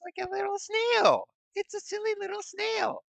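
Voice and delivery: very high squeaky cooing voice; cutesy voice. Pretend. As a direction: coo over it like a baby animal. Cooing over snail car.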